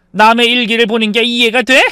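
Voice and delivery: indignant retort. Acted. Mother rejecting son's excuse for diary reading, indignant. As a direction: throw the excuse back at them.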